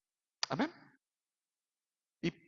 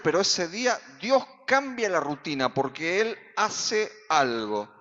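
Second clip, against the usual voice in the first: loud, rising pulpit preaching; fiery sermon climax; preaching at full force; 4 semitones higher, 6 dB louder than usual. Acted. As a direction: build the crowd to a shout.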